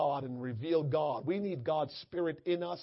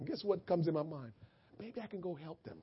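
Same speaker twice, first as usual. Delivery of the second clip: ordinary delivery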